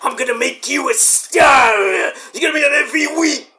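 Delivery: hammed-up boss promise, half shouted, half hushed; mock Hunter voice. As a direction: play the smug boss handing out empty promises. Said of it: "Hunter's like".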